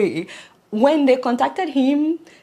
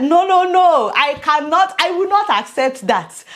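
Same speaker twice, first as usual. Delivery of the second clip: loud, insistent mock refusal, pitched higher; 6 semitones higher, 8 dB louder than usual. Vlogger to viewers, retelling her reaction: loud indignant refusal. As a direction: shout down the idea, half-playful. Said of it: "I said".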